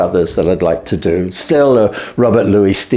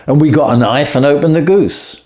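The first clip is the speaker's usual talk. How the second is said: ordinary delivery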